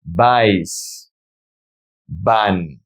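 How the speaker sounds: VAIS and VAN each said alone, falling; pronunciation model. Shown as a demonstration. Teacher drilling the verb to go for learners, pronunciation model. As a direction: model "Vais. Van." one isolated word at a time. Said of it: "Let's practice the pronunciation".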